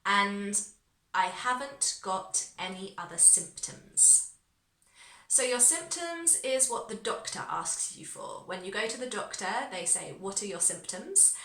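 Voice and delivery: usual speaking voice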